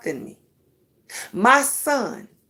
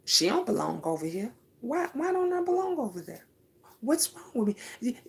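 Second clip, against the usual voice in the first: softer, wounded questioning; hurt inner voice; 9 dB quieter than usual. Retold or imitated. Voicing a rejected mixed woman's thoughts, soft and hurt. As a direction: ask it quietly to yourself, hurt.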